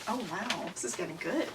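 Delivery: soft, low aside of mock awe; hushed mock-impressed aside. Author to listeners about her childhood story, hushed mock awe. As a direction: drop low and quiet, faking being impressed.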